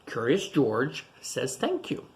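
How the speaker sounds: gentle storybook reading voice; reading to children. Acted. Teacher reading a picture book to children, gentle storybook read.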